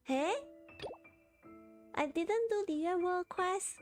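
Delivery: normal talking voice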